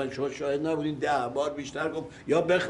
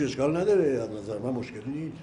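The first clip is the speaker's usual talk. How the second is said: ordinary talk, nothing marked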